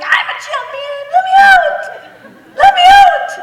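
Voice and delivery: put-on very high, loud child's shriek; inner little girl. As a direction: scream like a trapped little girl demanding release. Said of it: "the little girl in me was like".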